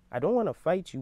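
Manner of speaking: ordinary delivery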